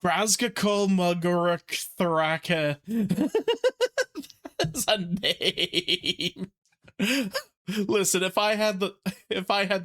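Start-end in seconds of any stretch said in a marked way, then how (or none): 2.9-6.5 slow, laughing through it. Real, not acted. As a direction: laugh out the words.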